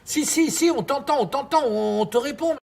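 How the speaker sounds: high, hurried reassurance; urgent reassurance. Acted. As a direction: rush to calm him. Host anxiously reassures caller.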